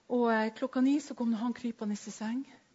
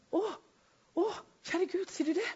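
high, startled gasp of a question; stunned high-pitched reply; surprised; 10 semitones higher than usual. Retold or imitated. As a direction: caught off guard, voice jumping up.

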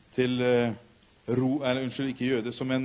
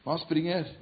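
usual speaking voice

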